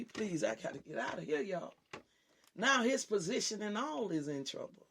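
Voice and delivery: usual speaking voice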